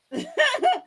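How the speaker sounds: high, loud laugh; sudden big laughing fit; burst of laughter. Real, not acted. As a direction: crack up in a high-pitched squeal.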